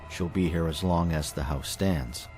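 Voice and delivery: ordinary delivery, nothing marked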